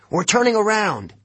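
high, decisive call; high-pitched command mid-race; urgent order. A narrator's reading.

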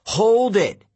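loud, high yell. Acted. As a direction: shout to stop them. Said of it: "I yelled".

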